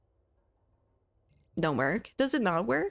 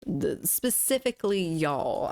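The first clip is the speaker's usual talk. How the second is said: ordinary talk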